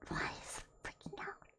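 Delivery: breathy under-the-breath whisper; muttered to herself. Real, not acted. Streamer puzzled by the tracking glitch, whispering.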